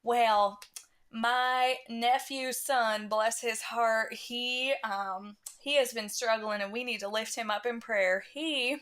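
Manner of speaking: slow, drawn-out Southern church lady; put-on Southern drawl, stretching the words; Southern accent. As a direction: drone a prayer request in a Southern accent. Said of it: "I adopted the Southern accent".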